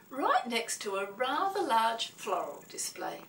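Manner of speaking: ordinary delivery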